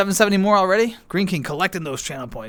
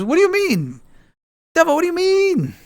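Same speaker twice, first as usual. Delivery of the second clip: high, incredulous "What do you mean?"; surprised disbelief; 13 semitones higher, 4 dB louder than usual. Real, not acted. Streamer reacting to a viewer's message, incredulous. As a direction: pitch jumps up in disbelief.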